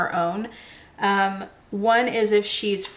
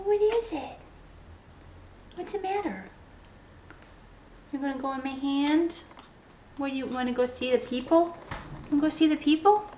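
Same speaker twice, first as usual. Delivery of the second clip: much higher, softer sing-song voice for the bird; pet talk; 7 semitones higher, 3 dB quieter than usual. Real, not acted. Cooing at her parrot.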